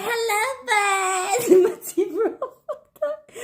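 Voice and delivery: high words breaking into laughter; laughing so hard the words squeak. Real, not acted. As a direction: crack up high while telling it. Turning around in fake teeth.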